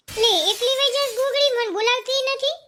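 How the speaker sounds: a hint of a fussy old mother-in-law; lightly voiced old woman. Mother-in-law scolding Gogdi for leaving alone, high and complaining.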